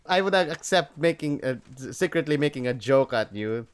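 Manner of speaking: ordinary talk, nothing marked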